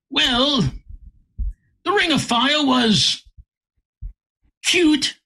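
mildly mocking, stretch it out; dismissive. Quoting the man's dismissive verdict.

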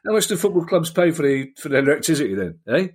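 a gruff no-nonsense farmer asking. Pretend. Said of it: "Slightly, slightly gruff".

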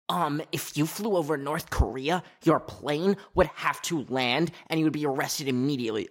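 imitating a pedantic commenter; mock know-it-all. Commenters correcting an obviously fake video, mock know-it-all.

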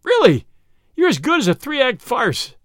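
high, loud burst of glee; delighted yell. A narrator's reading. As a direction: yell it out, tickled by the farce. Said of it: "Flambeau yelled with delight".

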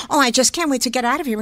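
fast chatter, the wrong way; rushed lines, not how Ingrid Bergman talks. Shown as a demonstration. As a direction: rattle it off in a hurry. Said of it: "You don't hear her talk that way".

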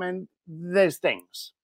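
slow right down and let it tail away; slow, evasive fade at the end; trailing drop-off. Retold or imitated. Caller dodges giving his real reason, trailing drop-off. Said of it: "it was that drop-off".

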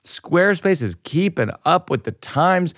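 upbeat host-read commercial. Acted. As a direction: sell it like a podcast ad. Host reads sponsor ad.

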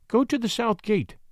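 high-pitched lady's instruction; lady instructing. A narrator's reading. The lady directs David to the gate, instructing.

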